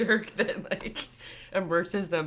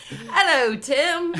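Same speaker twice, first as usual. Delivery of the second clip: loud, high mock-British "Hello, Tim"; mock British greeting; 10 semitones higher, 9 dB louder, much slower than usual. Replaying her own greeting to Tim, mocking her accidental British voice. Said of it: "Like, what?".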